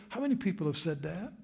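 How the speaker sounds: usual speaking voice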